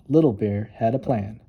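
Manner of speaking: plain reading voice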